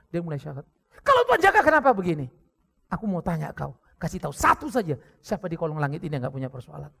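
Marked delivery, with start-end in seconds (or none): 1.0-2.3 loud, fast, higher protest; bitter challenge. Retold or imitated. Wife challenging the pastor about God, fast loud protest. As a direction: fire the question back.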